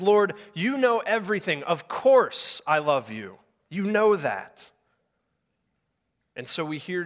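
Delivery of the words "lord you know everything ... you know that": raised, slow annoyed protest; hurt and exasperated, pleading; Peter, upset by the third question, protesting loudly. Acted. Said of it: "Peter's upset. He's annoyed".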